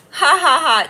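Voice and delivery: high loud hammed-up ha ha ha; mock villain laugh. Gloating at a commenter put in timeout, a hammed-up laugh.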